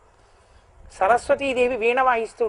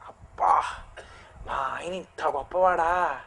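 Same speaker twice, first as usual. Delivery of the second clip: hushed, awed, lower and softer; soft wonder; 6 semitones lower, 6 dB quieter, slower than usual. Retold or imitated. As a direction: breathe it out in quiet amazement. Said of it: "విస్మయవతి, అద్భుత రసం పెడుతుందట".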